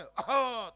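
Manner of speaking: loud gruff throat clear. Retold or imitated. Telling the old men to clear their throats, loud throat clear. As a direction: clear the throat hard to open the window. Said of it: "ખોખારો ખાવ ને".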